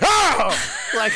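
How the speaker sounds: high, loud yelp of shock; retold scream. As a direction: scream out in mock horror.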